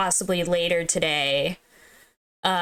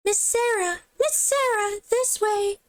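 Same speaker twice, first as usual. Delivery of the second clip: put-on much higher, calling a girl over; high guide voice; 11 semitones higher than usual. Streamer tries a new voice for guide So, calling high. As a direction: pitch it way up and beckon. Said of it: "I feel like I already had a voice for that too".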